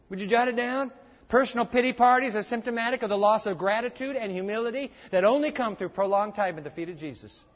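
ordinary talk, nothing marked

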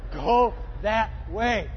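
loud, drawn-out order to the driver; acted-out command. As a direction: call it out slowly and forcefully. Acting out passenger's shouted directions.

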